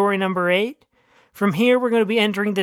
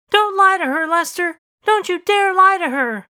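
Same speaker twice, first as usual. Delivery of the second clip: high shrill-free yelling dog, pitched way up and loud; dog shouting; 10 semitones higher, 4 dB louder than usual. Acted. Talking dog yells at husband not to lie, high and loud. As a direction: scream the accusation up high and loud.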